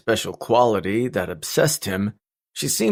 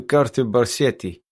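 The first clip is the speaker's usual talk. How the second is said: put-on heavy Russian accent; 3 semitones higher than usual. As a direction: say "Carter Barsetti" in a thick Russian accent. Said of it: "A heavy Russian accent came over the line".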